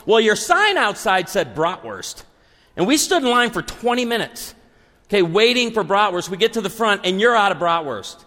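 high-pitched, mildly indignant retold complaint; ticked-off customer. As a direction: imitate a diner griping about bratwurst. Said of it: "we're pretty ticked".

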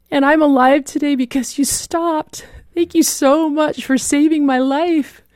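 halting start rising into a high, choked-up thank-you; thin, emotional voice. Retold or imitated. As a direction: imitate a grateful survivor, voice climbing with emotion. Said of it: "thin, emotional voice say".

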